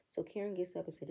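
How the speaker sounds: usual speaking voice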